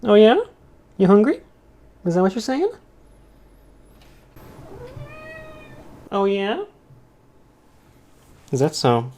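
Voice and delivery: high pet-talk voice, questions lifting at the end; talking to a cat. Real, not acted. Answering the cat's silent replies.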